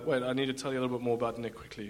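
ordinary delivery

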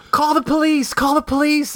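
high, urgent repeated plea; panicked wife. Retold or imitated. Wife begs him to call the police, high panic. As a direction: imitate the wife's panic. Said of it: "my wife's like".

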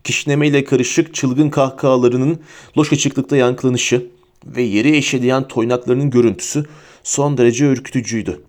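ordinary delivery

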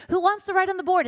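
high, bright mock teacher call. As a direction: lightly play the cheery teacher asking the class. Teacher voiced in story.

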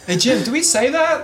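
high, disbelieving call-out; incredulous call. Real, not acted. Calling out to Jim.

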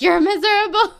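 high, loud laughing squeal; shrieking with laughter; laughing retort. Retold or imitated. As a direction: laugh it out at him. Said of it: "And I was like".

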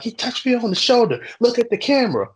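high-pitched, tattling imitation of an angry customer; mock complaining. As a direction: mimic a man griping to the manager. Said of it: "this dude's bitching".

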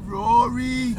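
high, loud imitated Geordie; comic Newcastle impression; Newcastle accent. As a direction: blurt the name in mock Geordie, half laughing. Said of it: "that's a good Newcastle".

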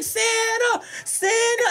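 high mock call of excited kids; high-pitched imitation of excited kids calling out. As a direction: call up to Santa like an eager child.

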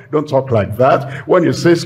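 ordinary talk

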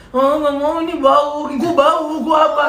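high, loud fussy whining; hammed-up spoilt drunk wail; whiny drunk. Friend imitates his fussy drunk mate being cleaned up, loud whining. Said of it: "dia rewel banget anjing".

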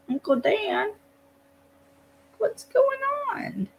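put-on high, slow, whiny little child; whiny kid voice. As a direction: play the innocent kid, whining. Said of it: "like, whiny kid voice".

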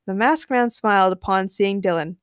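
ordinary delivery

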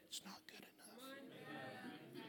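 slow, barely audible whisper; hushed, breath-only confession; whispered aside. Real, not acted. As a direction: whisper the hard truth slowly. Confides the hard truth.

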